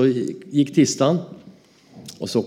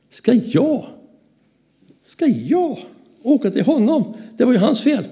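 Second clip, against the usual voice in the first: much higher, squeaky protest; indignant disbelief; 14 semitones higher than usual. Retold or imitated. Arguing with the Holy Spirit about apologising, indignant high voice. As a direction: whine it back like a stubborn child.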